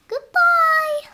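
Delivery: high, loud goodbye call. Acted. Calling goodbye.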